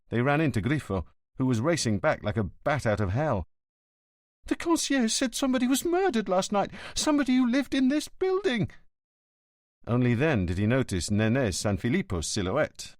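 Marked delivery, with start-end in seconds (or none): 4.5-8.7 much higher, frightened rush; frightened young man's voice; panicked son. Acted. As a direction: blurt it out in rising alarm.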